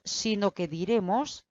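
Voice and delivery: ordinary delivery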